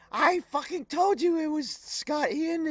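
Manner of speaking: a shrill wife rubbing it in; mock Sharon. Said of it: "I hear Sharon go".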